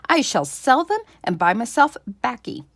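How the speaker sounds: put-on loud old farmer laying down the law; blustering farmer. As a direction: insist on your own plan, loudly. Farmer argues with wife.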